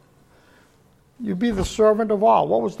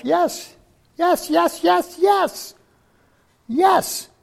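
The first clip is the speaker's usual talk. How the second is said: rising, ringing high yeses; an ecstatic preacher's yes, yes, yes; fervent yes; 11 semitones higher, 3 dB louder, much slower than usual. Real, not acted. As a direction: cry out yes with growing fervour. Preacher affirms giving up life.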